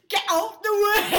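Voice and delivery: big, high, drawn-out shout; yelling. Acted. Player yells at the crowd to move, full shout. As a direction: bellow it at full tilt. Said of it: "I just yell".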